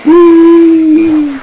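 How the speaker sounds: high, gleeful whee. Pretend. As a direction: squeal it like a kid sliding. Gliding in the game.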